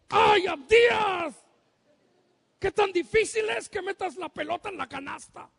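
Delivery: yelling at his son from the stands, exasperated; frustrated shout. Retold or imitated. Father yelling at son during basketball game, exasperated shout. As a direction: holler the name, then scold in disbelief. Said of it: "yo le grito".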